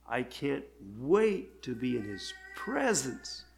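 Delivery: slow, lingering weight on "presence"; emphasis on presence. Retold or imitated. Father retelling his dying loved one's words, stressing "presence". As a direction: stress "presence", drawn out slowly. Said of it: "she emphasized that word presence".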